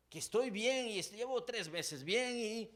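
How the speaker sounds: high, loud anguished plea, slow in places; mock anguished believer begging God; desperate believer. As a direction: plead like someone at the end of their rope. Voices desperate struggling believer.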